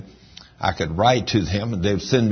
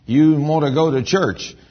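spirit man scolding; 6 semitones higher, 4 dB louder than usual. Retold or imitated. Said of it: "your spirit man will say".

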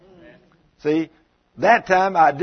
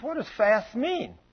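higher, slow puzzled question; imitated bafflement; 6 semitones higher, 4 dB quieter, slower than usual. Caller from Australia asks about fasting, imitated as baffled. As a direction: play the clueless caller, drawing it out.

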